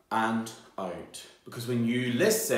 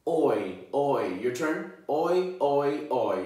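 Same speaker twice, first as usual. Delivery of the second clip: ordinary talk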